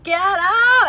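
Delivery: high, loud shriek at the spider; screaming in panic. Retold or imitated. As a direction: scream it out, terrified. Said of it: "screaming like a little girl".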